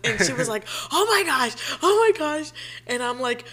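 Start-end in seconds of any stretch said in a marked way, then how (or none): 0.9-2.5 high, louder squeal of excitement; excited gasp. Retold or imitated. Friend Shelby reacting to proposal news, high excited squeal. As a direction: gush like a thrilled best friend.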